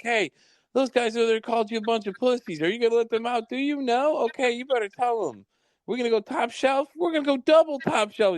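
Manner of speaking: manic crazy waiter. Retold or imitated. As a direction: egg the frat boys on in a high, wild voice. Retelling crazy waiter egging on frat guys.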